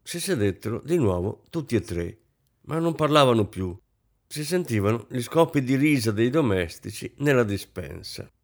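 plain reading voice

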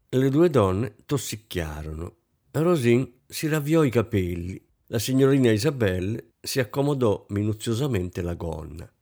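plain reading voice

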